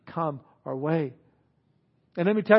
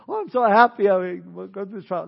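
giddy mock-happy quote, pitched up at the start; 2 semitones higher, 4 dB louder than usual. Preacher mimicking a believer happy in trials, mock happy. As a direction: gush with fake happiness. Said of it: "Not happy".

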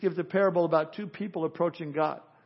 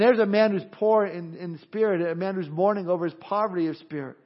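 ordinary delivery

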